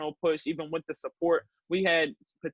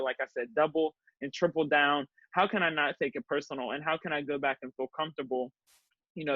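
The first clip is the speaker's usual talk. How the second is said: ordinary talk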